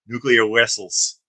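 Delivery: put-on "Nuclear vessels" said slowly with a hammy Russian accent, v as w, "wessels"; over-the-top Russian accent, drawn out; fake Russian accent. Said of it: "with his fake, uh, over-the-top Russian accent".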